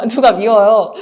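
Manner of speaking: loud, amused burst through a laugh. Real, not acted. Speaker giving listeners an example of a feeling, laughing loudly.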